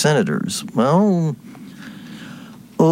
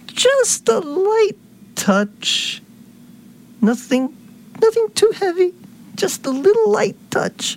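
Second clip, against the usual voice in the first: mocking senator voice. Host mocking a senator's call for light regulation, high falsetto. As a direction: sneer it in a silly high voice.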